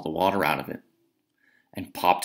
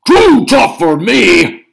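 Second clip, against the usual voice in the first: loud, slow, much higher "Too tough" roar; indignant shout; 13 semitones higher, 14 dB louder, much slower than usual. Acted. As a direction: roar it back, offended. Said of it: "shouted Cucullin".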